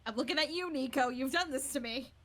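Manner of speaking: loud, pointed mock accusation; mock accusing. Singer blaming a friend for the song habit, loud mock accusation. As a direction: call out the culprit with playful blame.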